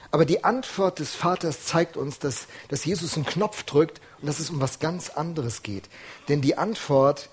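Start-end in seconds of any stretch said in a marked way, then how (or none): none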